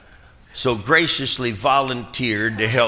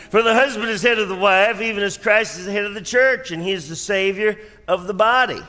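scripture reading; 9 semitones higher than usual. Acted. Reads scripture at full force.

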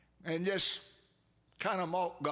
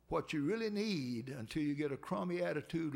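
ordinary talk

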